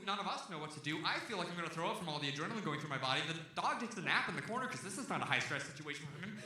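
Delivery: usual speaking voice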